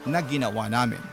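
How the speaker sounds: ordinary delivery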